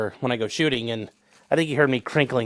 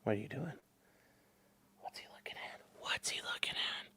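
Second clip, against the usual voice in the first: hushed unvoiced whisper; soft whispered question; 2 semitones lower, 20 dB quieter than usual. Real, not acted. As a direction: whisper so as not to startle the dogs. Whispering while watching dogs.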